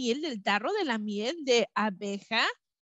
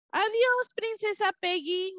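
high bee voices; 8 semitones higher than usual. A narrator's reading. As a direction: call it out like tiny bees. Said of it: "decían todos".

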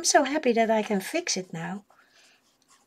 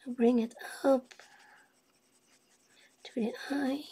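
whispering; 8 dB quieter than usual. Real, not acted. Concentrating while drawing.

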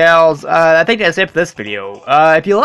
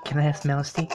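soft inner voice; 2 semitones lower, 11 dB quieter, much faster than usual. Acted. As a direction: murmur back as your inner voice. Inner voice asks.

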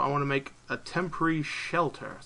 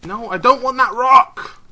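loud, high cry of frustration; raised voice, exasperated; exasperated protest; 9 semitones higher, 13 dB louder than usual. Real, not acted. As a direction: protest loudly at the wrong item. Frustrated by picking up wrong item.

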